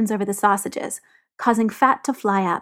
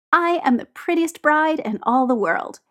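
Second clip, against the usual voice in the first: higher-pitched mock-bridal boast; grand announcement; 6 semitones higher than usual. A narrator's reading. Tyler in a tiara to gathered teammates, grandly announcing. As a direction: proclaim it playfully as a pretend bride. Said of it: "he announces to the collected teammates".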